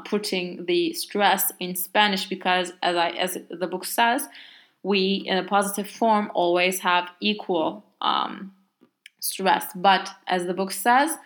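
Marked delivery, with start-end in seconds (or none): none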